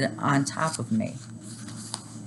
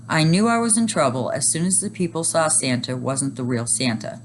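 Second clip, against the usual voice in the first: usual speaking voice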